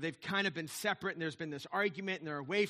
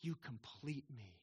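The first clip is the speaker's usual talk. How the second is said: very soft, low and slow romantic line; tender declaration; 6 semitones lower, 13 dB quieter, slower than usual. Acted. Returning movie hero declares his love, tenderly. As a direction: hush it right down like a movie hero.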